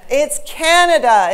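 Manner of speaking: CANADA with a loud, high, stretched first syllable; slow CANADA, weight on the first syllable; stress demonstration. Shown as a demonstration. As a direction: punch the CAN of "Canada", letting the rest fall away. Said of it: "that's the way stress works".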